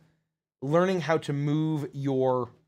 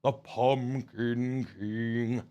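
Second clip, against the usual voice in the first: slow, deliberate character delivery with long pauses; lightly voiced character; 2 semitones lower, slower than usual. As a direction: draw it out, pausing between phrases.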